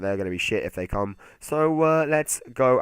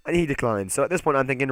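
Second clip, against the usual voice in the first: normal talking voice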